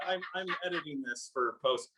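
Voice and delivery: usual speaking voice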